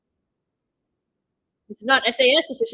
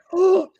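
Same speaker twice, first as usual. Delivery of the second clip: sharp shocked gasp; 8 semitones higher, 6 dB louder than usual. Real, not acted. Shocked reaction to the scene.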